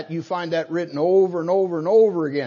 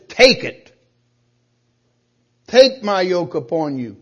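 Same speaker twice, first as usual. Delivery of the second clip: loud, raised, forceful command; commandment; 8 dB louder than usual. Acted. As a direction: order it like a commandment. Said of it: "is that a commandment that says".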